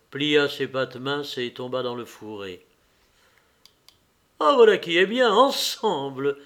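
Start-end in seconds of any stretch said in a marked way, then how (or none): 4.4-6.4 high, loud cry of approval; pleased exclamation. A narrator's reading. Lévin after a shot fired together, exclaiming with pleasure. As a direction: exclaim happily, drawing out the last word. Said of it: "s'écria Lévin".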